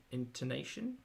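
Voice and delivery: ordinary talk, nothing marked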